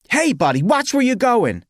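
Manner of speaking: loud, high-pitched outburst; indignant street holler; angry shout. Acted. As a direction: yell at someone who bumped into you. Fear turned to anger, yells at man.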